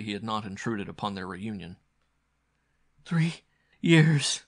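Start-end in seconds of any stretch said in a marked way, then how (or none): 3.0-4.4 high, louder cry of anguish; sobbing. Acted. Captive girl to her rescuer, sobbing. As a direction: plead it out loudly, a pitched-up sob. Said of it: "the girl was sobbing".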